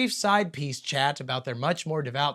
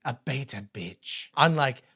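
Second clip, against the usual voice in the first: mock solemn slow; 3 semitones lower, slower than usual. Mock solemn biblical narration.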